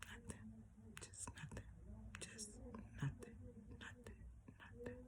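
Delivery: hushed repetition. Real, not acted.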